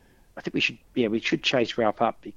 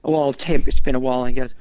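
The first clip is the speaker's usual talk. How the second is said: normal talking voice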